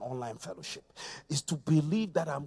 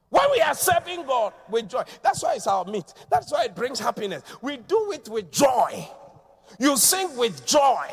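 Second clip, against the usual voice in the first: loud, high preacher's cry; preaching at full force; 7 semitones higher, 7 dB louder than usual. Real, not acted. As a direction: preach it out with joy.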